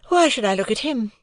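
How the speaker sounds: put-on a proud young woman answering lightly; Estella voice. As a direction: play Estella, poised and aloof.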